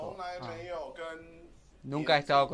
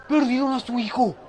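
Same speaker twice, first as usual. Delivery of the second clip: loud, high dismayed cry; alarmed shout; 8 semitones higher, 10 dB louder than usual. Real, not acted. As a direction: call out in alarm. Alarm at lost child. (grito alarmado)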